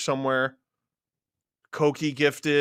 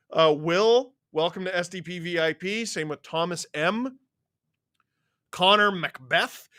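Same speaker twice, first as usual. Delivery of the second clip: ordinary talk, nothing marked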